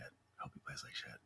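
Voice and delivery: bitter whispered repeat; hushed resentful whisper. Retold or imitated. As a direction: whisper it like a guilty secret. Repeated inner thought, whispered.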